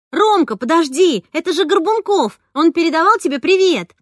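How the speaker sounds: urgent call across the yard; calling after. Acted. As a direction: call him back before he hides. Calling after a fleeing friend.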